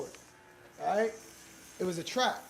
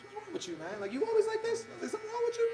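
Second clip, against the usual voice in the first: high mock alarm. To the gym guy, high bewildered disbelief. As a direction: voice jumps way up, bewildered. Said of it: "I said".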